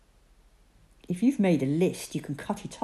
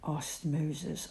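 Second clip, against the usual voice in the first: ordinary delivery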